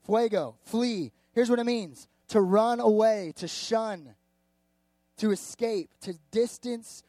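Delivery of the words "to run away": ordinary talk, nothing marked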